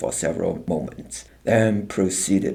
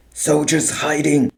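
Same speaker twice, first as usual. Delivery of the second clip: growled; 7 semitones higher, 4 dB louder than usual. A narrator's reading. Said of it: "the officer growled".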